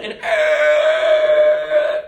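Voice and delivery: a shout of near disaster; mock scream. The car halts at the cliff, mock cry.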